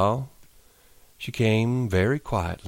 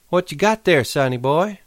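higher coy woman's voice; Curly's wife, teasing; 9 semitones higher than usual. Acted. Curly's wife teases Lenny in a higher coy voice. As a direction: tease him like a flirt.

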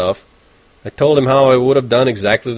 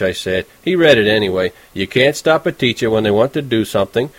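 usual speaking voice

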